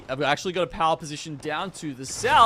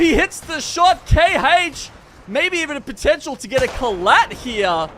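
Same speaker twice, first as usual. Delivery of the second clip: esports caster; 6 semitones higher, 4 dB louder than usual. Real, not acted. Caster calls a big shot.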